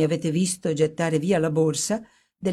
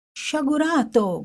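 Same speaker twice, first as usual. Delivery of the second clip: a much higher reproachful cry; cried out; 10 semitones higher than usual. A narrator's reading. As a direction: cry out as the notes burn. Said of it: "gridò".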